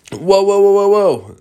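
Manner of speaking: loud, high string of alarm. Acted. Crew as a huge bird lands, loud high alarm.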